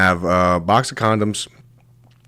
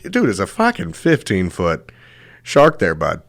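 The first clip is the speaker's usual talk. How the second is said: ordinary talk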